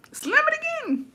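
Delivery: very high squeaky threat; escalated retold dare. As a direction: replay the warning way up high, without shouting. Acted threat, escalated repeat.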